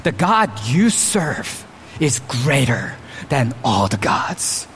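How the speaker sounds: low, soft, fading into a whisper of awe; hushed wonder at a great God; awed hush. Pretend. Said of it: "No, his response is".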